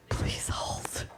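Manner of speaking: whispered please hold; quick whispered aside. Real, not acted. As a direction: hush it to the tech. Aside while video fails.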